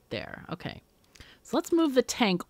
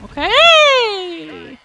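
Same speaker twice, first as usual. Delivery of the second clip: high, loud, stretched okay; startled exclamation; 13 semitones higher, 13 dB louder than usual. Real, not acted. Player reacting to the game, high startled okay. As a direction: let it shoot up high and loud.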